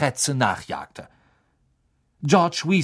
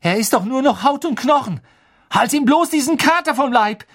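high, loud, indignant accusation; angry; 12 semitones higher, 6 dB louder than usual. A narrator's reading. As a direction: argue angrily, voice raised. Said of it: "sagte er wütend zu Hermine". (wütend und aufgebracht)

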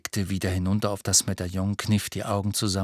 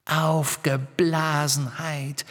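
high, slow sinister echo; eerie female phantom taunt; Riddle-Hermine; 5 semitones higher, slower than usual. A narrator's reading. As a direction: repeat it mockingly, drawing out every syllable. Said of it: "wiederholte die Riddle-Hermine".

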